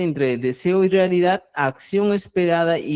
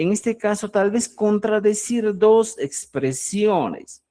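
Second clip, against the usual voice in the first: ordinary delivery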